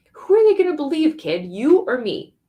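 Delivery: loud, high, playful challenge; a hammed-up grown-up bluff; mock threat. Teacher teasing the boy about who'll be believed, mock threat.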